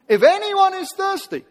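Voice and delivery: high, loud shouted invitation; loud call. Retold or imitated. Preacher voicing Jesus crying out to the thirsty crowd, loud and high. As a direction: cry it out to the whole crowd. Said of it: "in a loud voice".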